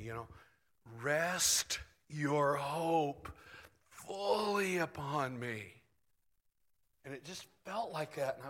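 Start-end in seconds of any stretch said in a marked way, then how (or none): 1.0-5.6 very slow, drawn-out divine appeal; leaning in, pressing. Retold or imitated. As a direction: press each word into the listener slowly. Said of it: "he was like, uh, so leaning into me".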